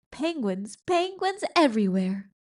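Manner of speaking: a storyteller's bursting delight; excited exclaim. A narrator's reading. Penguins run out in chaos.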